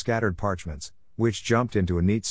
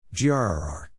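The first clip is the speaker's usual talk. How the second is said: hammed-up growl of pretend anger; a very unconvincing "grr"; mock growl, poorly acted; badly faked anger. A narrator's reading. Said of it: "award for the worst acting".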